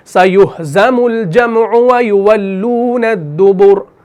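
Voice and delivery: slow, drawn-out tajweed recitation; Quran recitation. Shown as a demonstration. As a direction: chant the verse slowly. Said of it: "इस तरीके से".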